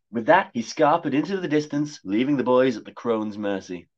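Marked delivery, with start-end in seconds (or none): none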